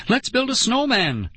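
high, gleeful cry; excited girl, pitch way up. A narrator's reading. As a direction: squeal the suggestion with delight. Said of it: "Lauren cried gleefully".

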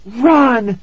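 high, loud urgent scream. A narrator's reading. Tara warns her parents to flee, screaming. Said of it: "Tara screamed".

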